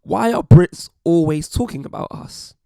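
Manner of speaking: mock commenter. Voicing Americans annoyed at Brits' comments, mock indignant. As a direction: lightly act out an indignant online commenter. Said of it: "comments along the lines of".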